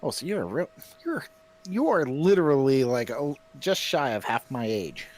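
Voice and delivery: ordinary talk, nothing marked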